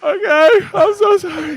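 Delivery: very high, loud squeal of apology; cowed freshman. Retold or imitated. Freshman apologizes to the senior, hammed-up panicked squeal. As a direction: squeak it out in mock terror. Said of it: "And I was like".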